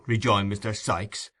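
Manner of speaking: ordinary delivery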